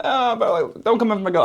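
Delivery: high, playful teasing; making light. Retold or imitated. To the woman at the cafe, teasing lightly. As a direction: joke it off, half laughing. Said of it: "I'm making light of it".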